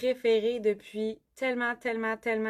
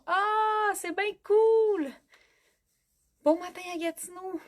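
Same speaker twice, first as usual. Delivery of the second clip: excited; 7 semitones higher than usual. Real, not acted. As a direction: gush with delight. Reacting to Gatineau viewers.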